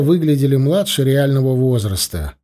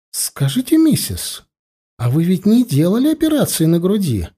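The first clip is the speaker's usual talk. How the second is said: high-pitched, nosy woman's voice; prying blonde woman; 6 semitones higher than usual. Acted. Blonde questions the wife about surgery, prying and high-pitched. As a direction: ask an indelicate question in affected high tones.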